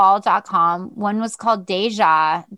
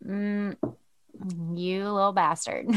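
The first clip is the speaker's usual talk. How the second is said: quiet mutter; 9 dB quieter than usual. Retold or imitated. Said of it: "I was like".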